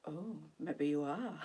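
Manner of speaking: slow, low, softly surrendering; mock giving in. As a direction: imitate slipping under, slowly and quietly. Mimics giving in to hypnosis.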